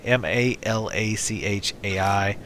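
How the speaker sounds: M A L A C H A I spelled slowly letter by letter. Shown as a demonstration. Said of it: "my character is Malachai".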